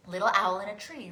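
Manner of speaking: quick spoken preview. Shown as a demonstration. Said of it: "We're gonna sing a second verse".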